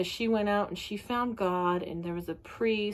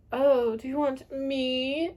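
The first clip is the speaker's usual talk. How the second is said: teasing; 5 semitones higher, 3 dB louder, slower than usual. Retold or imitated. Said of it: "she kind of teased him and was like".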